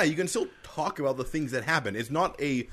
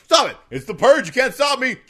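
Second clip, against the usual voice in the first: loud high yelling; shouting; 8 semitones higher, 7 dB louder than usual. Acted. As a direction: yell back defiantly. Acted shouted quarrel.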